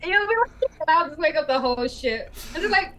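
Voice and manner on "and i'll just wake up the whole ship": usual speaking voice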